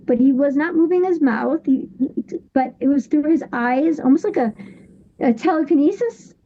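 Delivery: usual speaking voice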